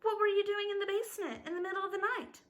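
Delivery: high, rapid-fire interrogation; demanding. A narrator's reading. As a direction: fire the accusation fast and high. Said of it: "they demanded".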